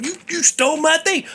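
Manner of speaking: high, stammered accusation; mock accusation. Telling a jerk he stole your speech, mock accusation. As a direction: go high as if calling out a thief. Imagined calling out the thief.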